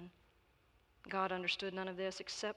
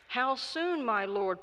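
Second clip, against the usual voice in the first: louder appeal, opening high; prayerful pleading; 5 semitones higher, 6 dB louder, slower than usual. A narrator's reading. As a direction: call up to the Lord in prayer. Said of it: "prayed the monk".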